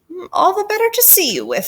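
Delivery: high-pitched mock granny voice; falsely sweet, high voice; wolf posing as grandma. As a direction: coo it high as a disguised wolf. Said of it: "said the wolf".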